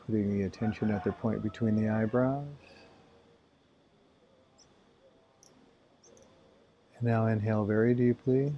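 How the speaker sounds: calm breathing instructions with long pauses; spacious, measured meditation voice; guided meditation. Acted. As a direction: guide them gently through each breath.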